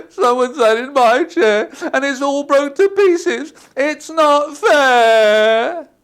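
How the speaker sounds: put-on high, loud whiny child voice; Baby Bear. Baby Bear finding his porridge gone, high whining. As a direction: whine it high like an upset little cub. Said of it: "And Baby Bear said".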